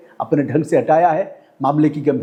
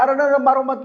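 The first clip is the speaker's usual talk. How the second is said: higher pleading cry of stop hitting; mock plea, higher voice; pleading quote; 8 semitones higher than usual. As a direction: beg them to stop, pitched up. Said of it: "उन्होंने कहा".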